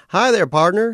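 cartoon otter greeting a new arrival, all sugary cheer; carnival otter voice. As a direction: gush like a cute carnival mascot. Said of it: "his electronic voice dripping with adorable carnivalesque".

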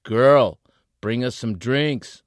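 loud, high call across the room. A narrator's reading. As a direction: shout to get the girl's attention. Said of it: "he called".

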